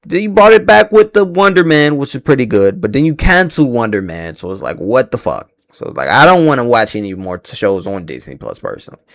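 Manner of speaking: ordinary talk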